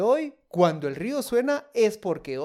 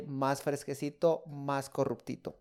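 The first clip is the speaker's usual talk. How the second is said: soft playful aside; 5 semitones lower, 8 dB quieter, slower than usual. Acted. Playful diminutive punchline.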